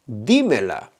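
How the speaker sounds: dímela with the first syllable DÍ stressed. Shown as a demonstration. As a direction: lean on the DÍ in dímela. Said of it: "notice that I'm emphasizing the verb".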